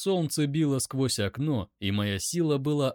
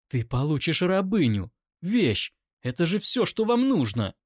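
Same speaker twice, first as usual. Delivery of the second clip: higher, climbing indignant outburst; furious accusation; 6 semitones higher, 3 dB louder than usual. A narrator's reading. Captive accuses him of wanting a slave, furious. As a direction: build the accusation to a high peak.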